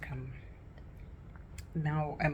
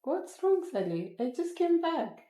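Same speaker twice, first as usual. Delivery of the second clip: high pet-talk voice; 12 semitones higher than usual. Acted. Talking to the pet Sally.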